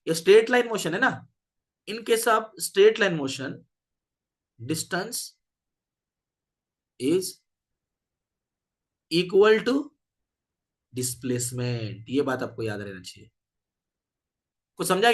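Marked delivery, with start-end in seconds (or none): 1.9-11.9 drawn-out dictation, word by word; dictating. Real, not acted. Teacher dictating the straight-line motion note, dictating. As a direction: leave long gaps so they can write.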